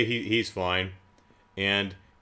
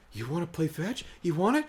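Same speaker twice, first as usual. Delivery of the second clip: higher, lightly cooed question to a pet; a hint of sing-song pet talk; playful dog owner; 7 semitones higher, 4 dB quieter than usual. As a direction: tease the dog with the ball. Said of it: "he's like".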